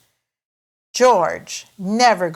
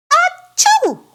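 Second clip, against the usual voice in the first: high, loud piggy sneeze; big sneeze; 7 dB louder than usual. Acted. George sneezes after losing his hat, loud high sneeze. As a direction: sneeze it out like a little pig. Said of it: "replies George".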